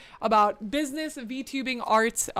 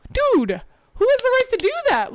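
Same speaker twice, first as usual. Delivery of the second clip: outraged retelling; 5 dB louder than usual. Retold or imitated. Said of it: "it made me so upset".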